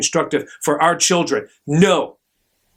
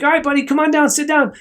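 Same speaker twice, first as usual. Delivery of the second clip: put-on higher-pitched kid-friendly doctor voice; talking to a small patient, pitched up; coaxing a child; 10 semitones higher than usual. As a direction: coax a little one in a raised, friendly pitch.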